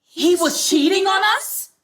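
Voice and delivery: high, loud and slow, stunned; shocked outcry. Acted. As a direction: cry out in disbelief. Learns of the affair.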